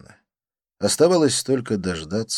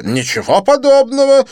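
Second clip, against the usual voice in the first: high, loud indignant protest; 13 dB louder than usual. Acted. Didier protesting Lin's accusation, high indignant cry. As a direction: bristle and deny it. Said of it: "возмущенно сказал он".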